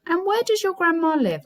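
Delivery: fake-innocent high wolf; sweet wolf. The wolf sweetly probing the girl, high voice.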